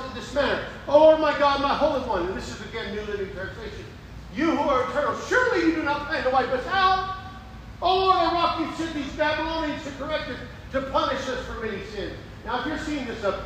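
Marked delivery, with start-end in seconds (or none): 0.9-2.3 loud, high cry to God; declaimed plea, raised voice; pleading prayer. Acted. Preacher reads Habakkuk's plea aloud. 4.3-12.1 declaimed plea, raised voice; pleading prayer. Acted. Reading Habakkuk's prayer with fervor.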